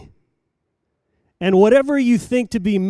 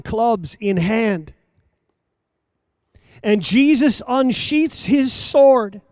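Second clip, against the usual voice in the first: slow, higher, louder deliberate preaching; preaching at full force; 5 semitones higher, 7 dB louder than usual. Acted. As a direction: lay out each phrase slowly with weight. Preacher's slow emphatic build.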